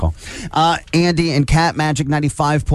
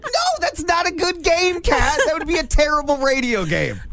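exasperated shout. Real, not acted. Host protests the game, shouting.

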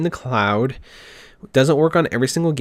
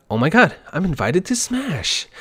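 put-on excited Animal Crossing girl; 5 semitones higher than usual. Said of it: "she's like".

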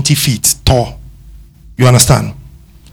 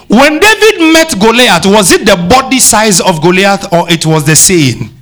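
rising preacher fervour; 6 semitones higher than usual. Real, not acted. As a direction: lift into a fiery pulpit challenge. Preacher rising to full force.

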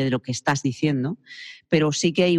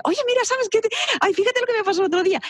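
much higher, fast excited chatter; attention-grabbing gossip; faster than usual. Shown as a demonstration. Teacher imitating a street gossip calling for attention. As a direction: grab a friend's attention with gossip. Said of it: "Utiliza un mecanismo de".

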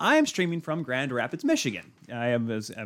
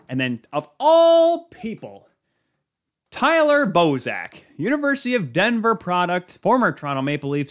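excited announcer build; 5 semitones higher, 5 dB louder than usual. Acted. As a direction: draw out the big reveal like a goal call. Dramatic build to the goal scorer.

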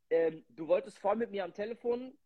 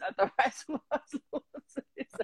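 laughing; 5 semitones higher than usual. Real, not acted. Laughs at own joke.

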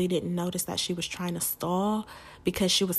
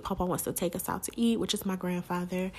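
ordinary talk, nothing marked

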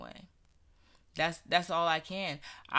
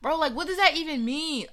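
higher, louder, fast annoyed protest; mock outrage, pitched up and rushed; exasperated retort; 9 semitones higher, 7 dB louder, faster than usual. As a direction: fire back at the friend, fed up. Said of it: "I used to be so mad".

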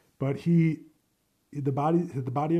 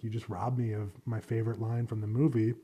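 usual speaking voice

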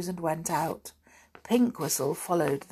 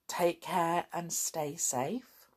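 ordinary delivery

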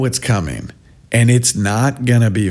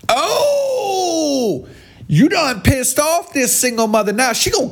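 high, laughing, hammed-up threat; mock fired-up mom; 12 semitones higher than usual. Parodying the commenter's threat, high mock tough-mom voice. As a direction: play the single mom ready to fight.

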